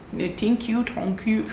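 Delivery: high, rising South African "thank you"; attempted South African accent. Shown as a demonstration. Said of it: "can I do the accent?".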